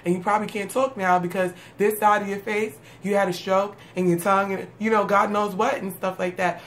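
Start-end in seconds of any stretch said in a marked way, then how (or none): none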